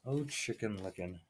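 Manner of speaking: plain reading voice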